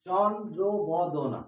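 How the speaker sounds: চন্দ্রবদনা stretched out, very slow, syllable by syllable; slow, careful teaching voice; slowly split into syllables. Shown as a demonstration. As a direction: spell চন্দ্রবদনা out slowly so the students hear each part. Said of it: "এখানে আমরা দেখতে পাচ্ছি দুটো বর্ণ আছে".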